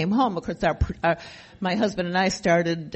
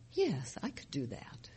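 soft, unvoiced surrender; whispered yes; 5 semitones lower, 12 dB quieter than usual. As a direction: give in under your breath. Quietly agreeing to sponsor.

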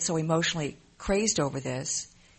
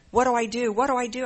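frantic; 7 semitones higher, 6 dB louder than usual. Retold or imitated. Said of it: "I was, like, just so crazed".

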